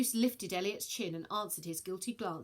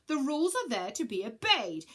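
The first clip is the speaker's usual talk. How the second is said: stern; 6 semitones higher, 5 dB louder than usual. Acted. Ares stern.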